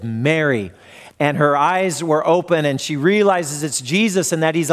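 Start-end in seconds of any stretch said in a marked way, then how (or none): 0.0-0.7 tender calling of a name. A narrator's reading. Risen Jesus gently speaks Mary's name to her, tender. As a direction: speak it like a loving recognition.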